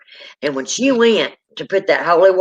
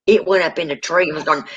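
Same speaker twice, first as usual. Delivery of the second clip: normal talking voice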